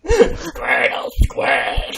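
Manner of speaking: very slow, wailing shouted call; wailing Pokemon cry. Acted. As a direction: howl the name like a crying creature.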